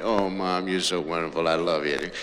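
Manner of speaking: soft, low loving words; tender. Retold or imitated. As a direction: sweet-talk your mom. Son sweetly to mom.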